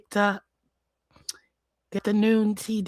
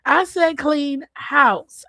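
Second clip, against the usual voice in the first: emphatic repeat; 7 semitones higher, 5 dB louder, slower than usual. Real, not acted.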